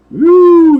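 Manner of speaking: high loud woo; a high hooting woo of delight; woo whoop. Real, not acted. Rider pulls over fast.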